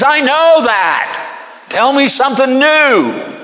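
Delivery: very high, loud, exasperated retort; shrieked, fed-up comeback; snippy and nasty. Acted. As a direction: snap back impatiently, dragging the words. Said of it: "being rather snippy and nasty with him".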